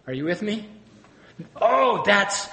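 usual speaking voice

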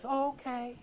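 put-on easy agreement; mock compliance. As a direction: play a meekly obliging reply. Mock compliant reply as Jesus.

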